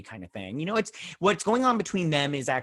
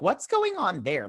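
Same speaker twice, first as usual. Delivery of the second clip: high, drawn-out bewildered question; pitched-up, slowed "what on earth" reaction; baffled reaction; 6 semitones higher, slower than usual. Retold or imitated. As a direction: ask it in comic confusion. Baffled reaction to film scene.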